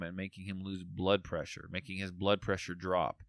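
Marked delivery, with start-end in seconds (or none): none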